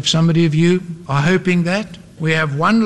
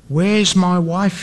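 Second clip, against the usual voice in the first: ordinary delivery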